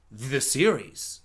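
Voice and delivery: low, slow subtitle announcement; mock title voice.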